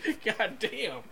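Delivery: high-pitched impatient complaint; laughing exasperation. Real, not acted. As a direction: gripe loudly through laughter at the long buildup. Impatient for the song to play.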